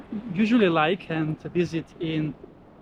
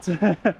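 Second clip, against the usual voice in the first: short laugh. Real, not acted. Hot sand reaction.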